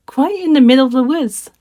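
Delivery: put-on an innocent child answering brightly; little girl voice. The trusting girl telling the wolf the way, innocent.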